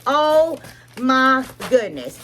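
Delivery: slow, high, delighted gasp of praise; drawn-out awe. Real, not acted. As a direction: gush over it slowly. Admiring products, repeats phrase.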